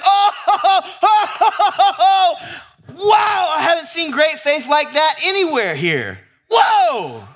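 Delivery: high, loud laughing marvel, ending in a whoa; Jesus laughing in amazement. Acted. Preacher acting out Jesus marveling at great faith, laughing amazement. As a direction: laugh out loud, then exclaim in delighted astonishment. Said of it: "Jesus laughed".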